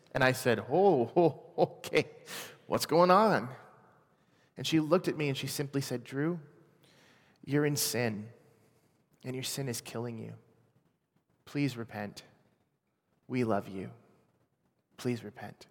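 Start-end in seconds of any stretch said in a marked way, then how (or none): 0.7-3.5 a startled, slightly raised "oh, oh, okay". Retold or imitated. Caught off guard by Amy. 6.0-15.7 quiet, earnest plea, much softer; gently begging him to turn back; soft pleading. Retold or imitated. Pastor's wife pleading with him.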